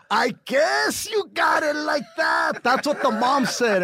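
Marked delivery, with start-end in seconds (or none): 0.1-2.6 high, loud, slow mock mom voice; the mom's voice. Imitating the girl's mom on speakerphone, high mock shout. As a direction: ham up the mother, stretched out. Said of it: "she goes".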